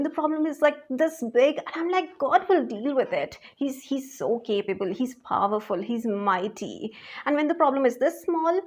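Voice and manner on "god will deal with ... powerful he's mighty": much softer, assured trust; soft steady faith; quiet calm confidence. Retold or imitated. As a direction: murmur it like you have no doubt. Retold confident self-talk.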